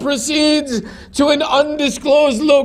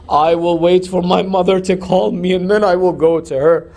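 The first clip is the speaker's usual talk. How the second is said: put-on grieving child's reply; 7 semitones lower than usual. Hussein's reply quoted in lament.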